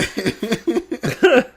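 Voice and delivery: loud high burst of laughter; big laugh. Real, not acted. Laughs after singing.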